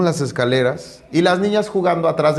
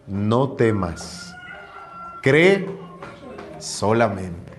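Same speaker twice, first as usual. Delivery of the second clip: gentle reassurance; 6 semitones lower, 5 dB quieter, much slower than usual. Acted. Jesus reassures Jairo.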